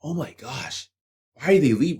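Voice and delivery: breathless, hushed mock gasp at first; hushed, sarcastic imitation of a stunned employer; mock amazement. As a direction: parody a baffled boss, near-whispered. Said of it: "like, amazed".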